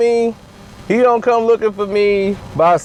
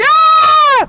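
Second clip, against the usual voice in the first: sudden high yell bursting through the door; a high, loud yell as the door goes down; raid yell; 6 dB louder than usual. Acted. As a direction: burst in yelling. Raiders bursting in yelling.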